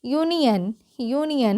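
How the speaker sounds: ordinary talk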